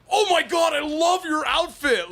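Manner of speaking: very high, loud, slow gushing shout; loud excited. Retold or imitated. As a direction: gush out loud at a stranger. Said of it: "as loud as possible".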